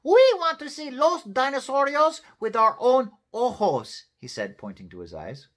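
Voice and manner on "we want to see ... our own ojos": high, loud, demanding little dog; tiny Chihuahua. A narrator's reading. Smallest Chihuahua insists on seeing dinosaurs, demanding.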